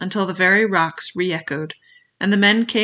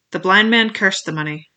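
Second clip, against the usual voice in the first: usual speaking voice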